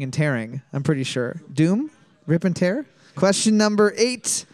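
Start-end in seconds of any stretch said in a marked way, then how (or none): none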